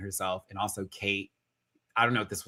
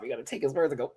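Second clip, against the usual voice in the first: usual speaking voice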